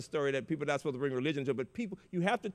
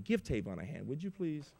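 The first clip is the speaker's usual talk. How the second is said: ordinary talk, nothing marked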